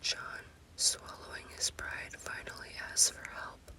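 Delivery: plain reading voice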